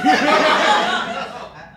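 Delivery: high burst of laughter; losing it laughing; loud laughter fit. Real, not acted. Reader cracks up.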